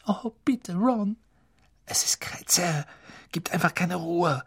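hohe, gedämpfte Mädchenstimme; Hermine flehend. A narrator's reading. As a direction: bitte Ron leise und hoch. Hermine and Ron voices. (Hermine fleht Ron leise an)

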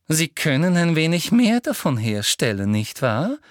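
put-on girlishly sweet anger. A narrator's reading. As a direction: sweeten the rage like a little girl. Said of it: "noch süßlich mädchenhafter". (süßlich mädchenhaft wütend)

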